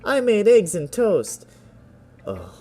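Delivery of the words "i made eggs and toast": high, slow, loud recital; quoting a poem line. Acted. Quoting friend's poem back, high drawn-out teasing recital. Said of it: "Especially that last line".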